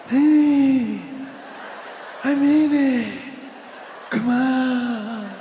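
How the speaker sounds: mock Biden speech trailing into mumbles; hammed-up Biden "come on, man"; mumbling Biden. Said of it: "fades into mumbles every time he gives a speech".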